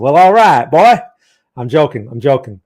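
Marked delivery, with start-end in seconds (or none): 0.0-1.0 loud hammed-up Texas drawl; jokey Texan voice; mock Texas accent. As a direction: play up the Texan for the viewer. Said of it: "making fun of my, my Texas accent".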